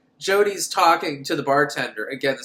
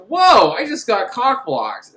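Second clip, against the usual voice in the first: loud, slow, raised mock outrage; put-out Tall Man; 6 semitones higher, 8 dB louder, slower than usual. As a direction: draw out a hammed-up indignant whoa. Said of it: "the tall man is like".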